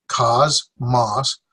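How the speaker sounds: "Cosmos" as two separate beats, KAHZ, MAHS; syllable by syllable. Shown as a demonstration. Teacher repeating for class, "Cosmos" split in two beats. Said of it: "one, two syllables".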